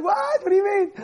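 very high, incredulous squeal of a question; hammed-up shocked protest; mock disbelief. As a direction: act bewildered, pitch shooting up. Acted bewildered reaction to angry accusation.

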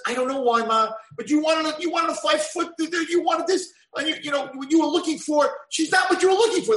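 high, loud protest to mom; pleading kid. Acted. Kid arguing with his mother about the girl, high pleading. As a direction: plead your case, voice climbing.

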